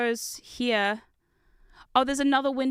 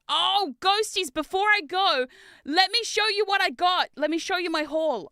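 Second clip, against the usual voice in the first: excited; 7 semitones higher, 3 dB louder than usual. Real, not acted. Excited address to viewers.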